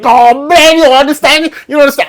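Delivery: high, loud Bajan accent imitation; imitated Bajan man. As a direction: mimic the Bajan customer. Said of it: "And the man's like".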